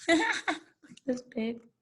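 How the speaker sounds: real giggles breaking through slow, soft words; cracking up while reading, softly and slowly; laughing. Real, not acted. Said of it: "This is making me laugh".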